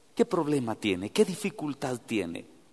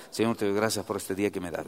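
rushed routine prayer; 6 semitones lower, 4 dB quieter, faster than usual. Retold or imitated. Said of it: "aquella oración de trámite".

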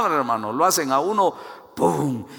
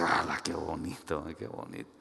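low, fading murmur of appreciation; soft, underwhelmed father's reaction; muted admiration; 10 semitones lower, 10 dB quieter than usual. Acted. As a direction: murmur it, dropping almost to a whisper. Father's muted reply to pretty message.

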